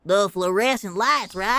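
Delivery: put-on low-pitched country accent. Landlord checks on the job with the housewife, country accent. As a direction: ask it in a deep country twang. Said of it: "I'll do a country sour".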